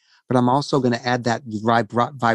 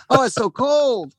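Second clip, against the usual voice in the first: whiny complaint; 9 semitones higher, slower than usual. Retold or imitated. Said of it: "inside I'm going".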